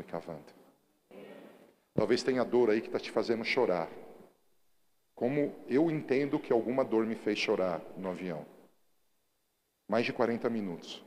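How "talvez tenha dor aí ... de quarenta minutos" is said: loud, driving sermon voice; fiery pulpit preaching; preaching at full force. Acted. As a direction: preach it out to the whole church.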